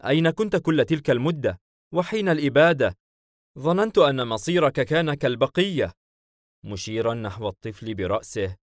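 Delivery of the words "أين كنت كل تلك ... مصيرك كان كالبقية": mildly excited, higher-pitched questions; a touch of joy at a reunion; happy. Acted. Hayim questions long-lost friend, happily animated. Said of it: "معالم السرور اتضحت".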